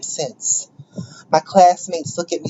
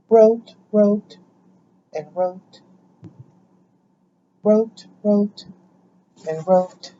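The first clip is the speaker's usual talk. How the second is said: rhythmic refrain; 3 semitones higher, slower than usual. Acted. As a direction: chant it in measured beats with long gaps. Spoken-word piece, chanted refrain.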